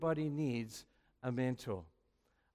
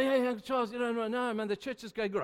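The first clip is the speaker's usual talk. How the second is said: higher, hurried evasive brushing off; nervously deflect the question; flustered dodging; 8 semitones higher than usual. Retold or imitated. Young pastor dodging his mentor's question, flustered and higher.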